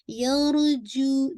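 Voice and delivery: यरजू stretched slowly, ज without air; slow careful यरजू; recitation demo. Shown as a demonstration. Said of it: "ये sound नहीं आनी चाहिए". (यरजू धीमा, ज में हवा नहीं)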